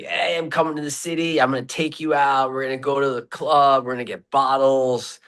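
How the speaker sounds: imitating an excited friend, pitched well up; high-pitched retold hype, rallying a buddy for a night out; hyped-up party invite. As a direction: play the party-animal pal calling up. Retold friend's boastful phone invitation.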